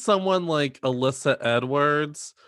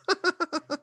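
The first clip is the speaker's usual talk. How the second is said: high squealing laugh. Real, not acted. Laughing at joke.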